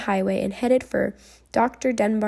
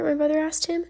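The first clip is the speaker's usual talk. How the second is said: usual speaking voice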